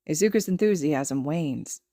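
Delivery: plain reading voice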